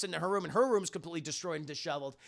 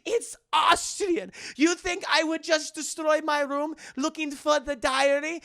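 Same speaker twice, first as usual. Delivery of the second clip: put-on indignant high German accent; 8 semitones higher, 4 dB louder than usual. Elsa correcting Indy about her accent, indignant German accent. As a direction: snap back in a mock German accent. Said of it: "You have a very German accent".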